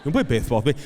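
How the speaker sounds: imitated lisped nagging; lisping four-year-old. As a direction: nag dad like a lisping little boy. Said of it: "he has a lisp right now".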